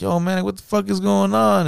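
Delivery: high-pitched, incredulous imitation; mock confrontation. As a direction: retell a bewildered challenge in a high voice. Acted quarrel in studio story.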